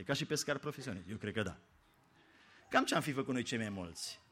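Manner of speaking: ordinary talk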